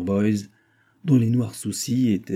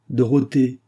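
sharp, louder rebuke of her name; stern cry; 4 semitones higher, 5 dB louder than usual. A narrator's reading. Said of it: "s'écria Dob sévèrement". (Dob rappelle sévèrement Dorothée à l'ordre)

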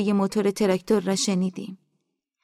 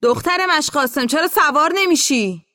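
high, raised call across a distance; pitched-up, insistent holler; shouting; 7 semitones higher, 4 dB louder than usual. A narrator's reading. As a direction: shout over to someone left behind. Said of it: "موسا فریاد زد".

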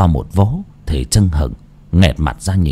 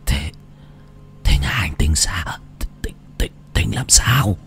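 thì thào; 4 semitones lower, 7 dB quieter than usual. Acted. Ông già hấp hối hỏi con út.

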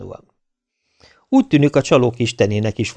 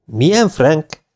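slow, higher puzzled question; 6 semitones higher, 4 dB louder, much slower than usual. A narrator's reading. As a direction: act as if you don't follow. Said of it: "értetlenkedtem". (értetlenkedő, elnyújtott kérdés)

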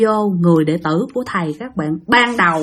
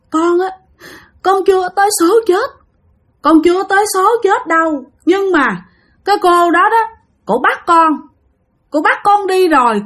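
higher, tearful, breathless plea; weary pleading ghost; 8 semitones higher than usual. Retold or imitated. Nephew's ghost begging his aunts for help, higher tearful voice. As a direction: beg your aunts through tired sobs. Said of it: "mà nói trong cái vẻ mệt nhọc lắm".

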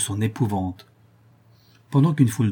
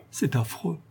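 tearful; 5 semitones higher, 5 dB quieter than usual. A narrator's reading. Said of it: "en essuyant ses larmes".

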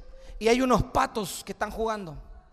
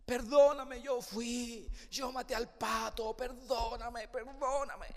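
crying plea; 8 dB quieter, slower than usual. Acted. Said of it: "llorando, llorando".

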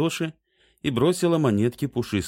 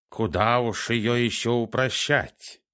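snooty bored cat; 5 semitones higher than usual. A narrator's reading. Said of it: "высокомерно фыркнул кот".

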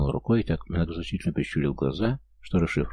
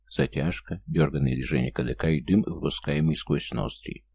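usual speaking voice

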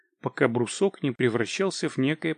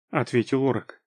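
ordinary delivery

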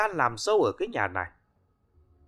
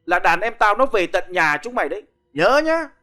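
loud, rising threat; menacing threat; 4 semitones higher, 5 dB louder than usual. Acted. Loan shark threatening the wife over the debt. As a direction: threaten her with his thugs.

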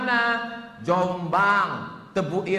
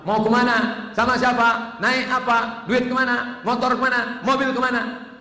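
higher, louder rapid-fire parent questions; nosy parent questioning; 6 semitones higher, 5 dB louder than usual. Retold or imitated. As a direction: grill the child like a worried mother. Parent questioning child, rapid checks.

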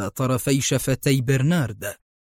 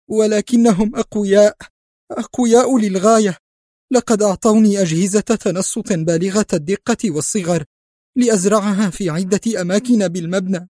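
trembling with fear; 7 semitones higher than usual. Acted. Spy warning the agents about the powerful organization, terrified. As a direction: shake with terror as you speak. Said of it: "بدأ جسده يرتجف".

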